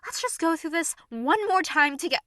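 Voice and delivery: loud, high, disbelieving shout; exasperated yelling. Acted. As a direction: yell it out in exasperation.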